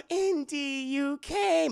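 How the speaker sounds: high, sultry woman's voice; breathy lady voice. Acted. Willie cooing seductively to Indy at her door. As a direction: coo it like a seductive leading lady.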